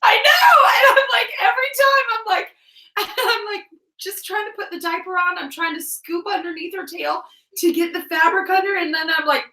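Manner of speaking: high, squealing through laughter; laughing embarrassed confession. Real, not acted. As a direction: confess it while cracking up.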